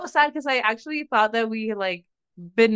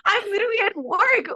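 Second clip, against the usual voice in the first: higher, exasperated protest; 9 semitones higher than usual. Real, not acted. Venting to cohost about customers at work, exasperated. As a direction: insist on it in disbelief.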